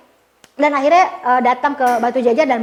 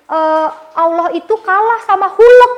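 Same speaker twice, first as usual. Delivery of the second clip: put-on child quoting friend; 5 semitones higher, 6 dB louder than usual. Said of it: "ada teman aku bilang".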